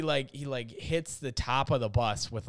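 ordinary talk, nothing marked

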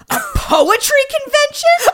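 high, loud incredulous squeal; scoffing outburst with a laugh; laughing disbelief. Real, not acted. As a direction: repeat it in shrieking, amused disbelief. Mocks the quoted alibi in disbelief.

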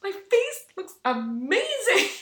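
high, slow, laughing marvel; laughing gush. Retold or imitated. Quoting herself at the mirror, laughing. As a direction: admire yourself with a giggle. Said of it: "And I was like".

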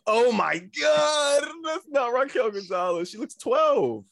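high, drawn-out disbelief; shocked. Real, not acted. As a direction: react in shock at the photo. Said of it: "Oh, hell no".

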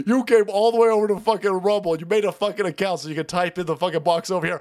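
ordinary delivery

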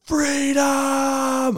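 long, high-pitched cry of freedom; yelling. Retold or imitated. Speaker on the phone celebrating his relative's freedom, yelling. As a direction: holler it out, holding the note. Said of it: "I'm yelling on the phone".

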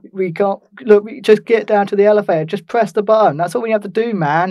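loud, high, exasperated ranting; angry rant. Retold or imitated. Stuck passenger ranting at the police, loud and exasperated. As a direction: yell it like a fed-up traveller arguing with police. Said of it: "He was like having a massive go".

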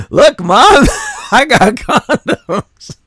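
put-on a young thief bragging to his mom on camera; proud kid. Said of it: "acted proud young thief".

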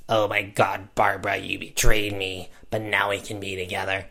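rough put-on smoker's voice for the villain; smoker dude voice. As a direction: rasp it out like a chain-smoking dude. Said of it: "smoker dude".

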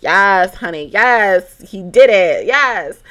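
loud gloating yes, honey, yes; the dramatic inner diva celebrating his chase; loud mock triumph over the ex calling; dramatic cheer. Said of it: "the dramatic me is like".

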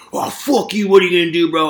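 put-on high, sneering drunk comeback; mock drunk retort. Drunk kid snapping at the narrator, mocking. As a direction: mimic the drunk guy snapping back. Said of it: "drunk guy retort".

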